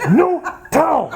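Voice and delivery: imitated yell. Korean students demanding towels in the kitchen, imitated shout. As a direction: shout it like an abrupt order. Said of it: "they would yell at me".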